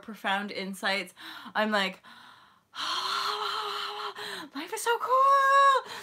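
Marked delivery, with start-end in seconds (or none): 2.8-4.5 long, high, soft sigh; blissful sigh. Acted. Vlogger marvelling at her insights, long blissful sigh. Acting out delight after insights. 4.5-5.9 high, drawn-out squeal of wonder; awed delight. Acted. Vlogger marvelling at her existence, squealing delight.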